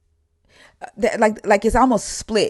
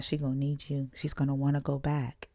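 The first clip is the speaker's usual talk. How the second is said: much softer, lower confidential voice; retold whisper; 5 semitones lower, 10 dB quieter than usual. Missionary confiding in the aunt at church, hushed imitation. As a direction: imitate someone murmuring into your ear. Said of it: "come whisper in my ear".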